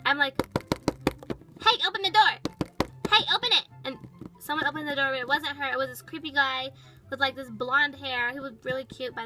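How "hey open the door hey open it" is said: loud, raised-pitch shout; demanding call. Retold or imitated. Girl confronting the van, demanding shout.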